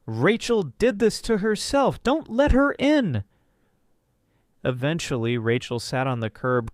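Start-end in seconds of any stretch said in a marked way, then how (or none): 0.0-3.2 loud, high-pitched urgent warning; flat-out warning. Acted. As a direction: raise the voice high and loud to warn her. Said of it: "told her flat out".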